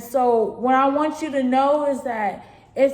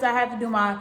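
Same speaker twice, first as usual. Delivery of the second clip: normal talking voice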